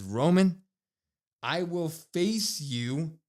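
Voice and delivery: slow, weighty retold declaration; CM Punk. Wrestler challenging his rival for WrestleMania, slow and portentous. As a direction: stretch each phrase, with big dramatic pauses. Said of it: "looks Roman in the eye and says".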